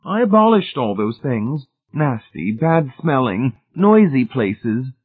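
high lady's voice, slowing on the nasty words; Missus Pritchett's prim disapproval. A narrator's reading. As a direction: dwell on each distaste in a high voice. Lady tells of abolishing nasty things.

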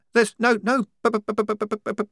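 put-on high, loud frantic stammering girl; frightened stammer. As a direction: stutter on the b in panic. Wazza stammering again.

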